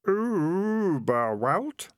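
very slow "Uberwald" with a long oo; "Uberwald" tried out as Oooo-berwald; drawn-out pronunciation. A narrator's reading. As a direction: stretch the first vowel of "Uberwald", pitched high, rising. Boy repeats the corrected word.

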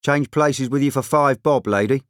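calling an offer. A narrator's reading. Someone in the queue to the whining woman, calling out. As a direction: call a bargain over to her.

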